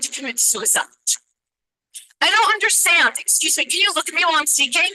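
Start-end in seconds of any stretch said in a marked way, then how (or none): 2.2-4.9 higher, heated and confronting; real anger. Real, not acted. Parent confronting the board members, really angry. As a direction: demand they pay attention, angry and pointed. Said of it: "forgive me for yelling at you, but I am angry".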